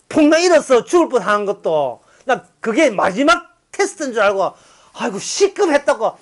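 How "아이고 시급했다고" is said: usual speaking voice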